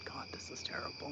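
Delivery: soft whispered horror at a sad story; whispered dismay. Retold or imitated. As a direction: whisper it as if tearing up. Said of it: "I'm like".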